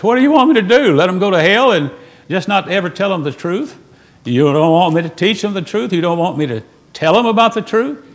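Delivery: rising pulpit fervor, pressing each question; fiery preaching. Acted. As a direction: preach it hard at the doubters. Preaching at full force.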